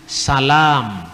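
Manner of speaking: "Salam" with a plain s and a long a; Arabic pronunciation. Shown as a demonstration. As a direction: let the a in "Salam" run long. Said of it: "menjadi A panjang". ("Salam" dengan sin dan a panjang)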